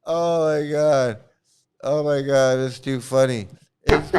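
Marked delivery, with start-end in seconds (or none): none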